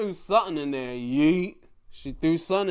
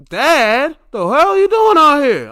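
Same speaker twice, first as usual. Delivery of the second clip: higher, louder shout at dad; mock angry yell; 11 semitones higher, 12 dB louder than usual. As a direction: yell at your embarrassing dad. Son yelling at his dad.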